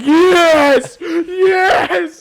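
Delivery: loud, high cheer, laughing; gleeful triumphant shout. Retold or imitated. Telling co-host of gloating at shaking hand, triumphant shouting. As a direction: crow it loudly in triumph.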